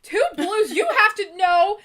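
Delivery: half-laughing at the challenge. Real, not acted. Laughing at the dare.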